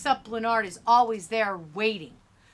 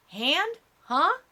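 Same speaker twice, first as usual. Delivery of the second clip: puzzled, high rising questions; lost and questioning; confused; 7 semitones higher, 4 dB louder than usual. A narrator's reading. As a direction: echo it back, baffled, pitch shooting up. Narrator fails to understand the question.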